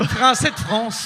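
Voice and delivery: imitation amusée de l'accent français; accent français de France. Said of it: "qui a un accent français". («français de France» avec un accent français exagéré)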